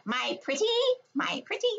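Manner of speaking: rising high witch taunt. Retold or imitated. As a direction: tease like a storybook witch. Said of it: "I know one thing she says a lot".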